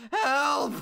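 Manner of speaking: high, loud cry for help; panicked yelp. Real, not acted. Streamer dreads the unmasked character, panicked yelp. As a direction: yelp as if cornered.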